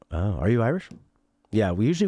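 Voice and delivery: ordinary talk, nothing marked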